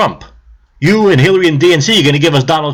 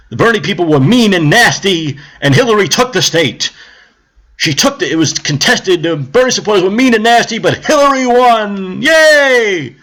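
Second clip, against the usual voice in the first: mock news voice climbing higher into a cheering yay; mocking media; 5 semitones higher than usual. Mainstream media mockingly cheering Hillary's win. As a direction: sneer it like a gloating anchor. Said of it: "come out and say".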